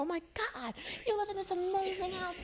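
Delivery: very high, awed exclamation; retold amazement. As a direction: gush in a high voice at what you see.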